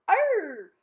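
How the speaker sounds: high, loud pirate toy bellow; talking pirate toy bellowing from the floor. Acted. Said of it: "that bellowed".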